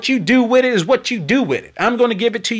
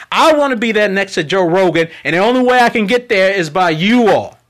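ordinary delivery

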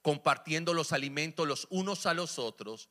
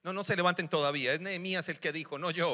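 usual speaking voice

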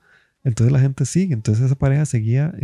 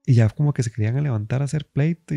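ordinary delivery

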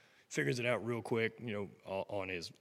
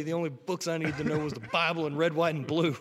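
louder, higher folksy yokel voice; folksy yokel boasting to a teacher; 6 semitones higher, 5 dB louder than usual. Acted. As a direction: play a proud backwoods patriot, laughing at the end. Mocking rural teaching lady quote.

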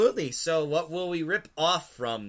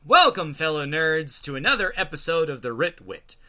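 loud, higher announcer intro; hyped podcast intro; 5 semitones higher, 8 dB louder than usual. Acted. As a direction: announce it to the crowd. Show intro announced big.

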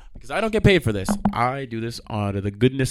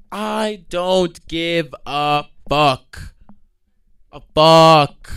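drawn-out, loud declaration; slow loud defiance; 3 semitones higher, 5 dB louder, much slower than usual. Pretend. Ranting after the retold yell.

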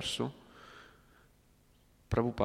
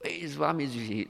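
hippie voice; 5 semitones higher than usual. Acted. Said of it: "Classico fricchettone".

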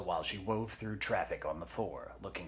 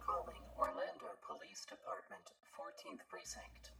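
high, very soft whispery announcement; automated watch voice; 12 semitones higher, 9 dB quieter than usual. Acted. Watch announces a police call, high and whispery. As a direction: speak as a tiny device.

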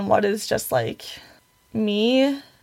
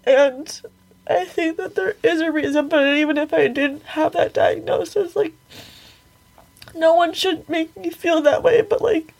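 talking through tears, pitch raised; real crying while speaking; 6 semitones higher than usual. Real, not acted. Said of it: "Sorry, I'm crying for those who can't see".

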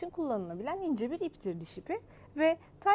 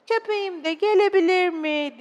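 louder, higher hopeful question; pleading child; 7 semitones higher, 10 dB louder than usual. Acted. Child asking father to bring the dog, hopeful.

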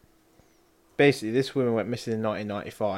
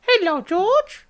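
very high, drawn-out creepy clown voice; Pennywise; 7 dB louder, much slower than usual. Acted. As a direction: squeal it slowly like a sinister clown, loud. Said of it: "[name]'s gone full Pennywise".

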